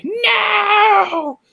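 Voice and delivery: long, very high mock scream; mock SJW wail. Reacting to the SJW face in the article, mock wail. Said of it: "I saw the S J W face".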